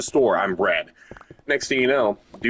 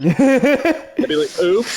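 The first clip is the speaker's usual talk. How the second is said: higher, louder, slow and giggling; laughing; 12 semitones higher, 5 dB louder, much slower than usual. Real, not acted. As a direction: laugh while telling it. Laughing retelling the game.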